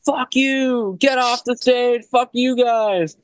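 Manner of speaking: high, mock screeching abuse; imitating a furious drunk woman yelling at the band; angry homeless lady. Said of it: "this woman's just like".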